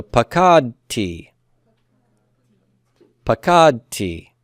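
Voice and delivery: pakad T slowly, KAD drawn out before a tee; pa-KAAD-tee, slow pakad T; slow model pronunciation. Shown as a demonstration. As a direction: model pakad T in spaced syllables. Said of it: "I is just T".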